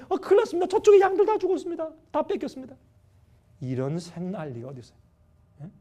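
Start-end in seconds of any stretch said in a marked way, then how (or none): 0.1-2.8 very high-pitched urgent report; alarmed messenger. Retold or imitated. Second servant rushes to Job with losses, high urgent alarm. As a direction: imitate another servant running in, voice pitched way up. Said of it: "또 한 명이 막 달려오더니".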